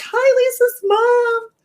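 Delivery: high, slow, sugary chat greeting; chirpy greeting. Retold or imitated. Viewers greeting the streamer's mother, high and sweet. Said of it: "Everybody's like".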